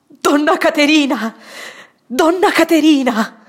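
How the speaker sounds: loud, high calls, breathless with emotion; calling loudly, moved. Acted. Mauro entering the dim room calling the dying woman, loud and shaken. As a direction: call her name into the dark room, choked up. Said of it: "chiamando forte, con affanno di commozione".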